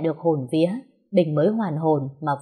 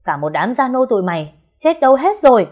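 yelling for the servants, higher and much louder at the end; angry shout; 7 semitones higher, 6 dB louder than usual. Acted. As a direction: bellow at the whole household. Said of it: "Bình lớn tiếng hét lên".

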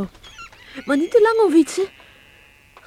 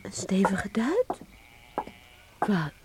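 breathless astonished whisper; 6 semitones lower, 8 dB quieter, slower than usual. Acted. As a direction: whisper it in disbelief. Astonished at news of money. (verbaasd gefluisterd)

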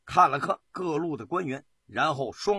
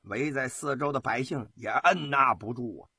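ordinary delivery

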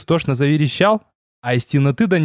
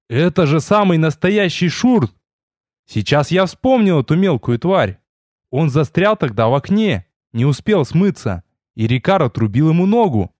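plain reading voice

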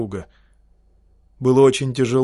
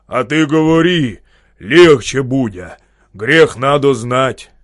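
louder, slow rumbling rebuke; a loud lecturing drone about sin; booming peasant; 8 dB louder, slower than usual. Acted. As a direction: boom it out like a bearded peasant. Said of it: "вылез вперёд и загудел".

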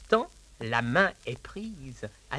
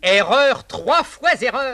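loud, rising, drawn-out insistence; triumphant contradiction of the master; emphatic correction; 7 semitones higher, 11 dB louder, slower than usual. Acted. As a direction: hammer home that he is wrong. Valet insists, louder and slower.

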